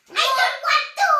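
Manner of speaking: high, loud, quick whine; protesting. Acted. Keeper told to sleep, loud whining protest. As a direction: refuse loudly.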